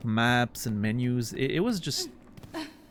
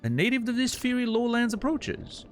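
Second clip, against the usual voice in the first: higher, theatrical flea showman; grandiose flea circus showman; 10 semitones higher than usual. Acted. Voices flea master dialogue.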